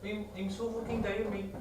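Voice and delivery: high, soft put-on Irish accent. Shown as a demonstration. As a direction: try out a funny Irish voice, softly. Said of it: "things sounding Irish".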